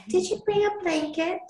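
high, bright Southern belle lilt; lightly Southern heroine asking sweetly; Southern accent. Acted. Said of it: "I don't know why I'm going Southern with her".

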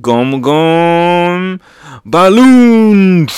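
drawn-out "gum gum", then high loud "balloon"; attack-name call. Acted. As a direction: build to a booming cartoon attack cry. Calls out attack move name.

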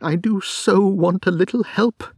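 a distraught wife crying out for help. Acted. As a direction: plead close to sobbing. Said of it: "she cried".

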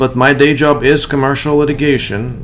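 loud, upbeat sponsor read over music; polished podcast ad voice. Acted. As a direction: sell the law practice like a radio spot.

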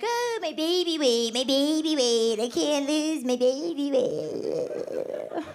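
slow, drawn-out whiny wail; mock moaning. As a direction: moan it out as if near tears, hammed up.

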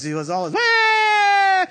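high shouted mock baby wail. Imitating her son crying at night, shrill wail. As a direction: wail like a newborn.

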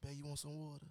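put-on hushed, gentle question to a sleeping girlfriend; soft quiet voice. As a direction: keep it low so she barely wakes. Waking sleeping girlfriend at night.

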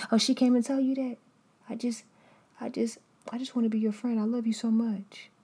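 high, fast, syrupy two-faced friend; mock fake friend.

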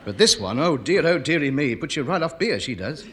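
ordinary talk, nothing marked

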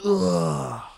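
long falling "ugh"; exasperated-sounding groan sliding downward. Real, not acted. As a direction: let out a groan that slides down from high. Groan amid solo ramble.

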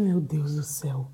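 slow, breathless whisper; retold stunned whisper; awed whisper. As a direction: hush it out in disbelief. Stunned at a million views.

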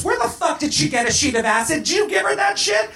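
high, angry interrogation; fiery anger. A narrator's reading. Joe turns on Willis over the acid, fiery anger. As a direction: fire accusing questions, pitch climbing sharply. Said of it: "with fire in her eyes".

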